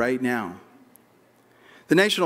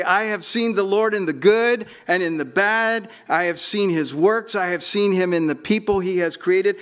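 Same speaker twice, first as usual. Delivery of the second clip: rising preaching; 6 semitones higher than usual. Acted. Pastor urging the congregation to claim the words, fervent preaching. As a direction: preach it out as a swelling declaration. Preaching climax, repeated testimony.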